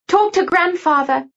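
high-pitched call, no real shout; calling after. A narrator's reading. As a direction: call it after someone leaving. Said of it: "Lucy called after me".

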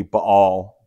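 Baal as "ba-AL", with a small stop between the vowels; "Baal" as buh, catch, AHL; original pronunciation. Shown as a demonstration. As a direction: say "Baal" in two syllables, Hebrew style. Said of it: "So in the original".

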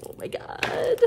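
high, teary whisper of awe; near tears. Real, not acted. Moved by the gorgeous colors, teary whisper. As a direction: whisper it as if about to cry. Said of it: "I'm gonna cry".

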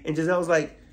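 ordinary delivery

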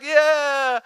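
high excited cheer; crowd cheer. Retold or imitated. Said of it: "everyone's like".

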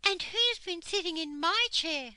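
high, loud, indignant mother bear. A narrator's reading. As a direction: complain as an offended Mother Bear. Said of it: "said Mother Bear".

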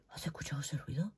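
susurro asustado, mucho más bajo; fearful whisper. Retold or imitated. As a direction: pregunta en voz bajita como quien teme un ruido. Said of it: "Es como cuando hay". (susurro miedoso imitando a alguien asustado)

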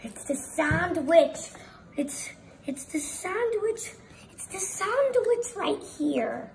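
high, loud story surprise; excited reveal. A narrator's reading. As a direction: announce the surprise, pitch climbing high.

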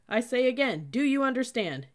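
loud, high military challenge; barked demand. A narrator's reading. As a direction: demand an answer from the squad. Cadet demanding louder answer.

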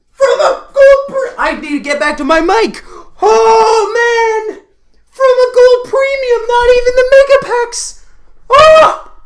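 high-pitched, loud squeals of disbelief; very excited. Real, not acted. Gamer stunned by a card from a gold premium, excited squealing. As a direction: shriek over an unbelievable stroke of luck. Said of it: "I got very excited".

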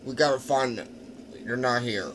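loud, urgent searcher; play-acted search call. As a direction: call out loudly to the search party. Acted search for hidden people.

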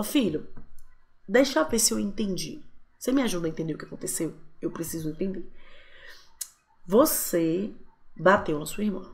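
soft reassuring parent voice; gentle calm tone. Shown as a demonstration. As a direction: invite the child to explain, tenderly. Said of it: "nesse tom de voz, eu não tô acusando".

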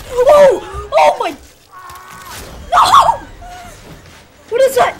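high, loud cries of shock; horrified shrieking. Real, not acted. Gamer seeing something horrible in game, horrified shrieks. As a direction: shriek in real alarm.

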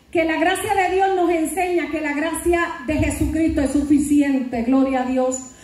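ordinary talk, nothing marked

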